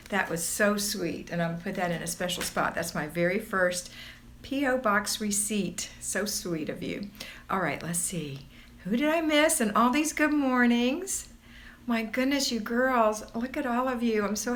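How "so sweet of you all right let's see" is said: soft unvoiced whisper. Real, not acted. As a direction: breathe it out to herself.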